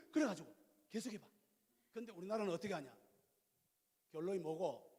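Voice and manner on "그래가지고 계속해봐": fast, gasping "그래가지고 계속해봐"; breathless fast "계속해봐"; impatient rush. Shown as a demonstration. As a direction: hurry her on, eager to end it. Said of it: "듣는 건 속히 하고".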